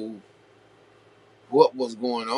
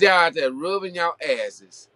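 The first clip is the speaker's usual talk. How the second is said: higher-pitched retelling of a crude scolding, half-mocking; imitating a blunt scolding; 6 semitones higher than usual. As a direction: quote her scolding the crowd, voice pitched up. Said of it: "She said it just like that".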